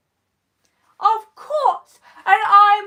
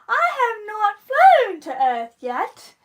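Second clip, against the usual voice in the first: usual speaking voice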